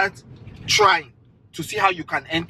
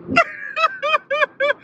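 a high squealing laugh. Real, not acted. Still laughing at her.

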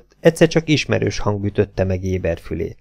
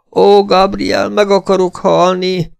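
high, loud wail; wretched cry; 10 semitones higher, 4 dB louder, slower than usual. A narrator's reading. The wretched Esmeralda, eyes shut, crying out. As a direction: cry out in despair. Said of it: "kiáltotta a szerencsétlen asszony".

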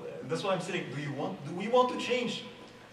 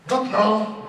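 sharp loud snap, acting annoyed; mock irritated outburst; 5 semitones higher, 11 dB louder than usual. Imitating his own annoyance at the pop-up, loud snap. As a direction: throw out an irritated refusal. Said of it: "my initial response will be what".

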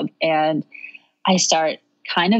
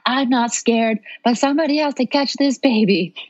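higher-pitched mock yell, urgent but contained; retold yell; 6 semitones higher than usual. Labouring mother to her husband from the bathtub, retold yell. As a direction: call out urgently without full volume. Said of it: "yell out".

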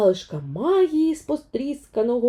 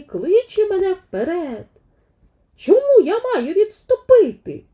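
proud declaration of her lineage; determined Vaiana; 9 semitones higher, 4 dB louder than usual. Acted. Vaiana answers grandmother's spirit, proud, high and building.

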